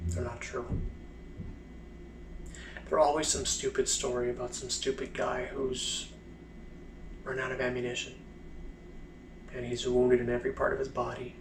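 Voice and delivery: quietly simmering resentment, long pauses; bitter soldier holding it in, phrase by phrase; pissed off. Acted. Blind soldier refusing a woman's heroic war stories, pissed off but restrained. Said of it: "that's when he kinda gets pissed".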